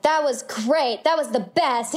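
slow, drawn-out mock gushing; slightly put-on admiring voice; overheard gushing praise. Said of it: "you could sometimes hear".

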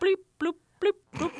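high beeping robot noises; toy robot voice. Pretend. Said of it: "say something funny".

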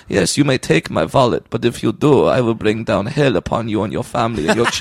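menacing mock Russian accent. Mugging victim threatening the mugger, Russian accent. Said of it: "started going into Russian accent".

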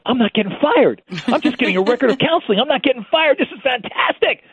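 high, giddy relieved delight; high ecstatic inner voice, not getting fired; ecstatic relief. Retold or imitated. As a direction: bubble over with relief. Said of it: "I'm ecstatic at this point".

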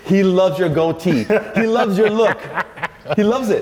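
high, excited caller voice with a laugh. Retold or imitated. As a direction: play the thrilled casting agent on the phone. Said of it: "I get a phone call".

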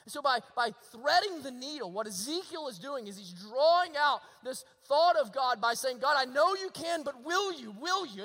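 emphatic sermon delivery; impassioned preaching. Real, not acted. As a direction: explain it with fervour, driving toward the plea. Preacher voices the prophet's plea.

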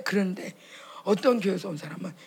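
normal talking voice

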